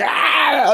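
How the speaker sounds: loud, high mock shriek; gremlin shriek. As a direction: shriek like the gremlin smashing the windshield. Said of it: "Whilst the gremlin's going".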